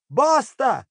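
high-pitched blurted yell; sudden high call. A narrator's reading. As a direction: blurt it high like a malfunctioning talking poster. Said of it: "em um tom progressivamente mais alto".

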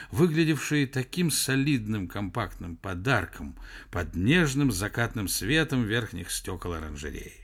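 usual speaking voice

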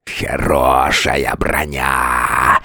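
deep, slow, snarling beast; angry monster voice. A narrator's reading. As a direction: growl it low and slow like a monster. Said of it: "послышался злобный голос".

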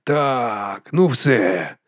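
resigned sigh; a touch of weary resignation. A narrator's reading. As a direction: let it out as a drawn sigh. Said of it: "зітхнув Ендрю".

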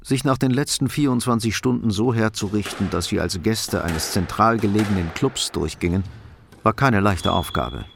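ordinary delivery

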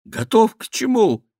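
high, loud, jumpy query; nervous, startled king; alarmed question. A narrator's reading. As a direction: ask with voice shooting up. King fears what comes.

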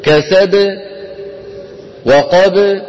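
spring the final ب in كسب and وقب; final ب released with an echo; qalqala on ba. Shown as a demonstration.

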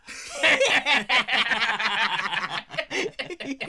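jeering thug laughter; mocking laugh. The thugs laugh mockingly at the cowboy stranger. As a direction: cackle at the stranger.

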